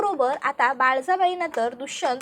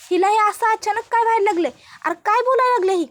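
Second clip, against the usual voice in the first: alarmed; 6 semitones higher, 5 dB louder, faster than usual. Acted. Old woman alarmed by Pooja's fit.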